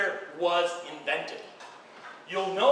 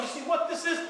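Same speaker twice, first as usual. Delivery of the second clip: emphatic call-out; 6 semitones higher, 3 dB quieter, faster than usual. Real, not acted. Speaker rouses the audience.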